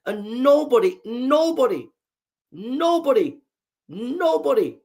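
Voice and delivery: each "nobody" pitched high, then falling; emphatic repetition. Real, not acted. Speaker insisting on civilized living, "nobody" hammered emphatically.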